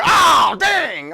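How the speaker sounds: a sudden loud, high "oh, dang"; surprised shout. Retold or imitated. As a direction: blurt it out startled. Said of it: "Then, then you're".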